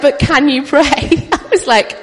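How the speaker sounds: words pushed out between laughs; laughing. Real, not acted. Telling the congregation about lost teeth, laughing loudly.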